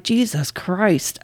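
slow, quiet exasperated mutter; hushed disbelief at his blunt offer. Retold or imitated. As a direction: sigh it out under your breath. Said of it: "And I'm like".

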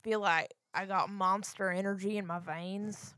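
put-on rural Florida accent, like Ruthie from Ozark. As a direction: play a backwoods Florida trucker. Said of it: "You sound like Ruthie from Ozark".

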